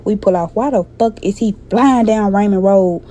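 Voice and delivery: normal talking voice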